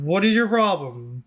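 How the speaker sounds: ordinary talk, nothing marked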